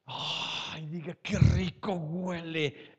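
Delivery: slow, drawn-out delight after a deep breath; God savoring a smell. Acted. God sniffing worship and enjoying the aroma, slow savoring. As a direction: breathe it in and savor each word slowly. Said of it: "que Dios respire".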